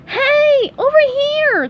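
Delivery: high, loud call; high cartoon shout for attention. A narrator's reading. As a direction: holler to someone far away. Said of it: "called SpongeBob".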